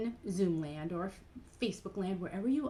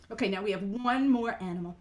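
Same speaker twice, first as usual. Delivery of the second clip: ordinary talk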